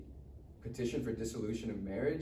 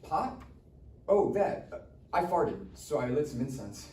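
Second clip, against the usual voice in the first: higher, quick flustered lying; nervous excuses; 6 semitones higher than usual. Acted. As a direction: talk fast to cover it up. Caught by mom, making excuses.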